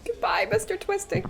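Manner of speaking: soft, hammed-up farewell; mock eulogy. Mechanic softly mourns her lost spanner in mock eulogy. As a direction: bid a tender farewell to a tool.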